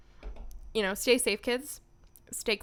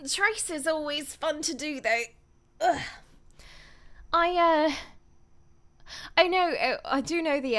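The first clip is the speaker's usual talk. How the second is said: put-on British accent, higher and louder; 7 semitones higher, 4 dB louder than usual. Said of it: "now I'm in my accent".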